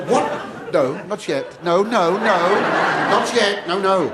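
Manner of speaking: loud, high "no, no, not yet"; loud mock-pleading no; protesting shout. Real, not acted. Shushing an audience that keeps laughing.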